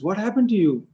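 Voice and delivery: high, loud bewildered questioning; growing surprise. Retold or imitated. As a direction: demand answers in astonishment. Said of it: "he was more and more surprised".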